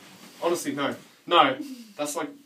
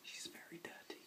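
whispering; 30 dB quieter than usual. Pretend. Teasing remark whispered.

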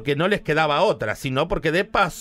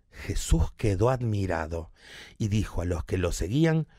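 adorned reading; 9 semitones lower, 5 dB quieter than usual. Acted. Comedian reading scripture in a put-on voice. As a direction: intone it like a holy reading. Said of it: "te la cuento adornada y maricona".

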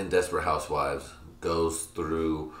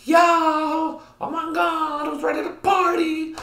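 very high, loud mock party squeal; over-the-top high-pitched party yell; hammed-up party entrance; 5 dB louder, slower than usual. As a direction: burst in shrieking like a wild party mom. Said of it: "You're gonna go through the door like".

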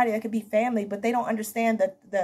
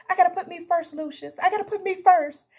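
high, louder crying plea, mock-tearful; imitating Cookie crying; 9 semitones higher, 3 dB louder than usual. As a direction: wail it like Cookie, pitched way up. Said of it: "And she's crying".

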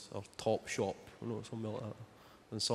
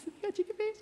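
squeaky higher cooing repeat; even higher baby talk; faster than usual. Retold or imitated. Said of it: "they can just go".

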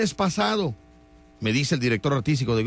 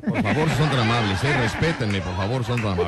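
laughing plea; 4 semitones lower than usual. Real, not acted.

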